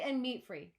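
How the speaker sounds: plain reading voice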